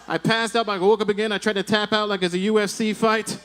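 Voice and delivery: ordinary talk, nothing marked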